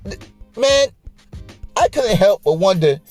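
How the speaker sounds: ordinary talk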